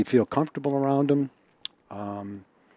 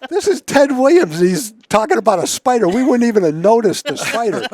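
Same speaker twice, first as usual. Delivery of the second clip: high, loud mock amazement; amazed teammates; 11 semitones higher, 6 dB louder than usual. Teammates marveling that Ted spotted a spider, high loud amazement. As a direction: gush like stunned teammates. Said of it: "watching the game going".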